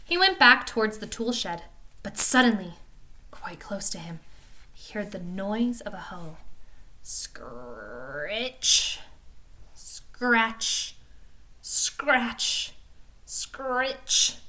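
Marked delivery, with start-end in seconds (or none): 3.3-4.9 whisper. A narrator's reading. Sudden danger nearby. 7.0-14.4 drawn-out scritch scratch noises; hoe scraping sound. Acted.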